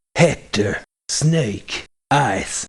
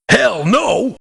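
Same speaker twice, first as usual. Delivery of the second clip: gruff refusal; 7 semitones higher than usual. Acted. Gruff merc refusing to join.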